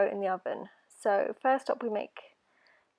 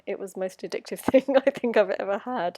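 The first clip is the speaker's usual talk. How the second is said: laughing; 7 dB louder than usual. Real, not acted. Laughs about the addictive brittle.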